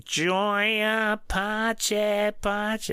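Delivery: high, sustained nasal sing-song; nasally pedant. Retold or imitated. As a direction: sing it through the nose like a boring music teacher. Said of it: "puts on this nasally tone".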